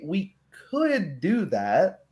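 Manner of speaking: normal talking voice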